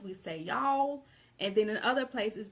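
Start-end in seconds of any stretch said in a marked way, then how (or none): 0.4-1.1 "y'all" as one long, higher Southern syllable; Southern drawl. Shown as a demonstration. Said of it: "with our Southern accents and draws".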